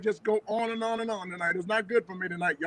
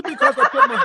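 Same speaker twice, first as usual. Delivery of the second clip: very high, laughing words; 9 dB louder than usual. Real, not acted. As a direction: crack up as you say it. Laughing at himself.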